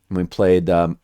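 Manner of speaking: ordinary talk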